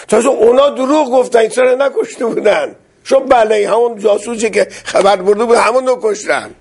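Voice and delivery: heated correction. Real, not acted. Preacher correcting a claim heatedly.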